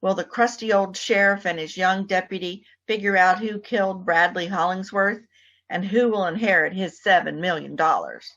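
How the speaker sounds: ordinary delivery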